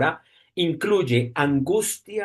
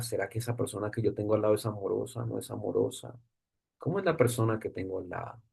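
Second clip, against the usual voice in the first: inner musing; 7 semitones lower, 10 dB quieter, faster than usual. Retold or imitated. Wondering about your neighbour.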